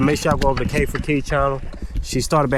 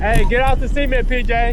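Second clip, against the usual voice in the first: calling out; 9 semitones higher, 4 dB louder than usual. Real, not acted. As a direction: call out to stop them. Dad calls out to kids riding.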